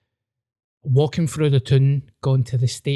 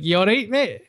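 higher pitched retold question; retold concerned check; 7 semitones higher than usual. Comedian retells checking on a sky-gazing comic, higher voice. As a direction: ask it lightly, higher. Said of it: "I was like".

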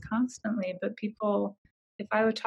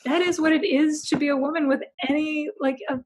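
incredulous outrage; 7 semitones higher than usual. Retold or imitated. Her outraged reply retold.

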